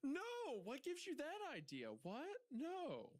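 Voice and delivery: very high, exaggerated protest; mock indignant denial. Denying any beef with Italians, hammed-up high protest. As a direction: squeak out an over-the-top denial.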